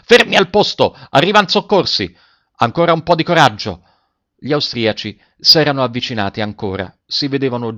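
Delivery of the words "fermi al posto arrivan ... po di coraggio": high, loud captain's command; rallying shout. A narrator's reading. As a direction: shout to hold the troops in place. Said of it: "gridò il capitano".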